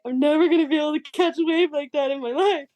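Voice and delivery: put-on a teenager choking up over a dream; almost crying. Surfer retelling waking from the dream, near tears. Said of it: "crying almost because I was like".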